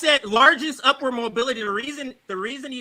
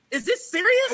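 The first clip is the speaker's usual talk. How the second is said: incredulous; 10 semitones higher than usual. Real, not acted. Outraged repeat to host.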